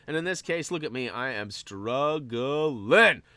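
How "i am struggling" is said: very drawn-out, syllable by syllable; hammed-up struggling. Player confessing he is stuck on the puzzle, hammed-up struggling. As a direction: stretch out the struggle word theatrically.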